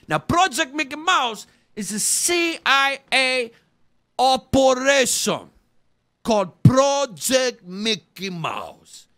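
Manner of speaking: loud, slowing proclamation; loud reveal. Acted. Conspiracy theorist unveiling Project Mickey Mouse, loud and slow. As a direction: proclaim the secret operation like breaking news.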